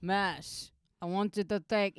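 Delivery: put-on deadpan order in a Yemeni-Israeli accent, face unmoving. Commander ordering soldiers, deadpan Yemeni-Israeli accent. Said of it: "I can do him in, in Hebrew".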